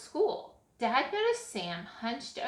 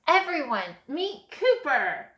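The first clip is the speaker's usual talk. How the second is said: proud announcement; 6 semitones higher, 8 dB louder than usual. A narrator's reading. Boy introducing his dog to class, proud announcement. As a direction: present him with a flourish.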